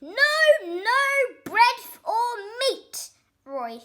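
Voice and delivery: very loud, high, slow bratty yell; a spoilt boy bellowing orders; furious child's shout. A narrator's reading. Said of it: "Roy shouted".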